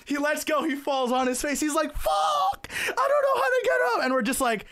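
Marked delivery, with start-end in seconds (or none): 1.9-4.0 very high, wailing mock distress; helpless wail of a fallen skier; imitated yelling. Skier face down in the snow, imitated panicked wail. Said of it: "He starts yelling his fucking".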